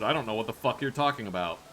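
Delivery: usual speaking voice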